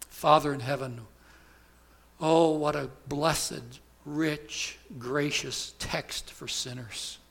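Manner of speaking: pastor praying to close the sermon; pastoral prayer. Acted. Pastor leads closing prayer.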